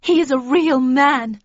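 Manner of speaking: loud, high and slow; impassioned declaration. A narrator's reading. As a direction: proclaim your love over the hecklers. Catherine declares love over barracking.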